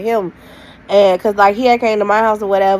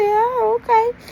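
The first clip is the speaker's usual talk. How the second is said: mock surprise; 14 semitones higher, slower than usual. Hearing he is moving away.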